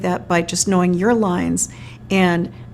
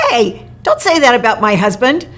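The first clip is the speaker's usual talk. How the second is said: acted outburst, higher and louder; mock sudden anger; 4 semitones higher, 5 dB louder than usual. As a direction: overdo an abrupt, indignant objection on cue. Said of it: "you respond and you're angry".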